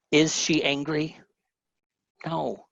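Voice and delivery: ordinary delivery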